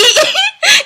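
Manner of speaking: high delighted laugh; burst of laughter. Real, not acted. Laughing at being trolled.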